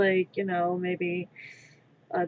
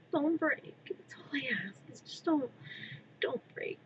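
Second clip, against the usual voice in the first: breathy whispered begging, softer; pleading whisper; 4 semitones higher, 9 dB quieter than usual. Real, not acted. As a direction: whisper as if your breath could snap it. Begging a fragile part not to break.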